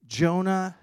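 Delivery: ordinary talk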